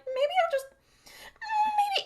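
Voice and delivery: very high, sing-song villain musing; coy demon voice. Pretend. Podcast host voicing the demon's idle musing, high and coy. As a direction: tease it like a smug demon wondering aloud.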